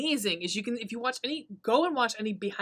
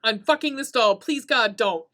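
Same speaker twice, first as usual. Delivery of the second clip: higher, louder panicked pleading; alarmed protest; 7 semitones higher, 5 dB louder than usual. Retold or imitated. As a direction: beg the movie to stop, mock horror. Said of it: "I'm like".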